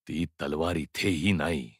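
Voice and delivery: slow, low, soft disappointment; dejected. Acted. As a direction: let it sink, slow and hopeless. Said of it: "आता निराश स्वरात म्हणाला". (निराश, खालच्या संथ स्वरात)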